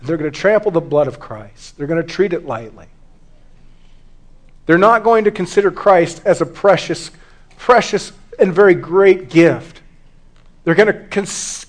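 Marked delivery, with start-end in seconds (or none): none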